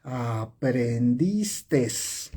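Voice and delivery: "aprendistes" very stretched out, ending in a wrong extra s; mispronounced example. Shown as a demonstration. Teacher repeating a bad Spanish example for students, very drawn-out "aprendistes". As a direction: draw every part of "aprendistes" right out, final s included. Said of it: "Es como si en el español tú dijeras".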